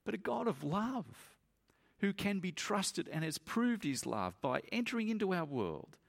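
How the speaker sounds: high, pleading sermon climax; impassioned preaching. Real, not acted. Preacher pleading with his congregation about God's love, impassioned. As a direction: plead with them at full height.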